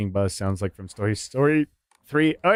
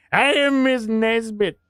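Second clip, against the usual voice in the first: high, drawn-out cartoon cry; hammed-up, slow announcement in a high voice; Buzz Lightyear; 11 semitones higher, 3 dB louder, slower than usual. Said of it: "I've also had Buzz ones".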